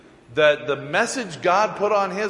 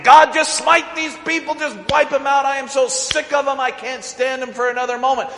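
fed-up outburst; 9 semitones higher, 5 dB louder than usual. Retold or imitated. Moses venting to God.

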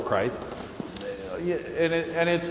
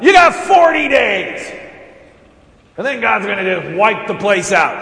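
high, loud proclamation; prophet's shout; 5 semitones higher, 9 dB louder than usual. Acted. Jonah shouting doom at Nineveh, loud and high. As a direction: cry it out to the whole city.

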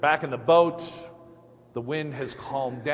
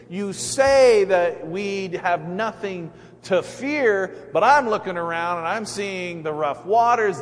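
doubting Peter; 5 semitones higher, 6 dB louder than usual. Acted. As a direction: lay out every fear, voice raised over the waves. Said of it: "Peter still doesn't believe him".

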